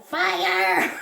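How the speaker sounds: drawn-out mock yell of "fire"; half-held-back street yell; yelled alarm. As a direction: act out raising the alarm. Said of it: "and yell".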